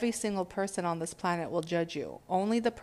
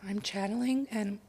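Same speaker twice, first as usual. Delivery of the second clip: whispering; 3 semitones higher than usual. Real, not acted. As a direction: drop to a whisper. Whispers mid-talk after a pause.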